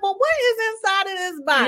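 high-pitched, amused mock wonder; animated retelling. Fan retelling a favorite cartoon episode to a co-host, animated. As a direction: play up the curiosity, voice lifted high.

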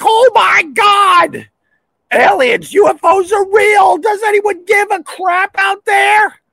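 very high, loud panicked screech; mock screaming. Replaying his alarm about aliens, high mock scream. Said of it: "And I'm screaming like".

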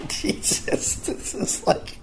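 wheezing then whispered disbelief; breathless laugh. Real, not acted. Comic laughing at the burger ordeal, whispered.

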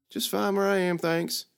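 put-on teasing girl voice, pitched high; sassy young girl. Lily needling the knight about heat, teasing. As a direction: poke fun at the knight's logic.

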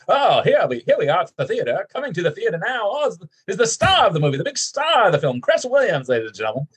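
high excited event announcer. Retold or imitated. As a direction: hype up the star's arrival like a ringside announcer. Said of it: "announcer voice".

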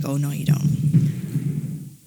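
slow, low mock warning from her own ego; ego holding her back. Her ego stopping her rise, slow taunting voice. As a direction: drag the words out like a smug inner voice.

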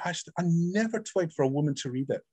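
normal talking voice

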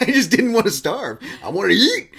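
high, loud laughing words. Real, not acted. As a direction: laugh through it. Laughing while answering.

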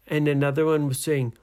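ordinary delivery, nothing marked

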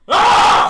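sudden high, loud scream; imitated scream. Roommate imitating his friend's night-terror scream, loud and sudden. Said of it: "And he just screamed like that".

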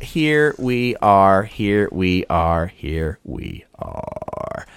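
slow descending repeats, trailing away softly; drawn-out stalling. Pretend. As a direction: stretch each word, winding down like a dying battery. Stalling while page loads.

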